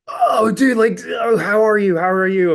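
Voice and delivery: loud, high-pitched mock-frantic greeting; sweaty, worked-up fan. Sweaty fan greeting the band at the bar, worked up. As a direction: imitate an overexcited guy, voice way up. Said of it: "red in the face like, kinda sweaty".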